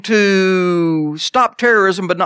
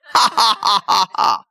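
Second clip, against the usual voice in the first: mock laugh; slower than usual. God laughing at the enemy.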